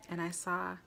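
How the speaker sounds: ordinary talk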